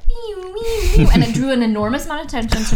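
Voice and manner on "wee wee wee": high, slow imitated beeps; machine beeping. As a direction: copy the checkout alarm, high and drawn out. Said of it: "it was like".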